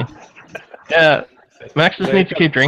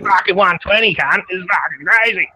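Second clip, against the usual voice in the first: mock Aussie bloke; 3 semitones higher than usual. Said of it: "crocodile Hunter".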